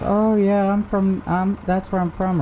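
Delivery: high, hesitant fake answer; mock scammer voice. Imitating the scammer pretending to know the town, high and fumbling. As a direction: pitch up and fumble the lie. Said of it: "and they're like".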